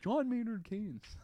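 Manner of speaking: slow, drawn-out imitation of the old teacher; lightly mock the old business teacher's grand intro; a hint of the droning professor; mock teacher impression. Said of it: "that was our impression of".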